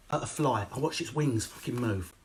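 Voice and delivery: ordinary talk, nothing marked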